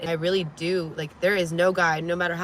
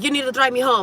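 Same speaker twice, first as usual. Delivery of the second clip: demanding ex; 7 semitones higher, 6 dB louder, faster than usual. Retold or imitated. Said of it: "And he's like".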